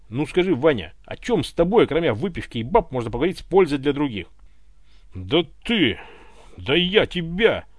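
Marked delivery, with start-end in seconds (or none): 5.1-7.6 sputtering, slow, a bit louder; huffing, choking on his own rage; offended pilot splutters at his rival; puffing with anger. A narrator's reading. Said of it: "запыхтел Иван".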